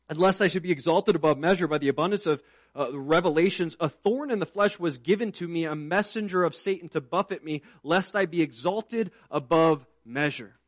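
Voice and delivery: reading scripture from the pulpit. Acted. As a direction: read the verse out with weight. Reads scripture aloud.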